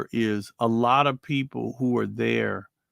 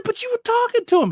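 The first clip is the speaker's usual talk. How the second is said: much higher, fast, disbelieving; stunned disbelief; 4 dB louder, faster than usual. Retold or imitated. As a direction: blurt it out in shock. Retold disbelief at death.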